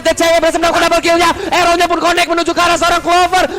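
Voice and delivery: fighting game announcer. Real, not acted. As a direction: scream the kill call. Casters call a triple kill.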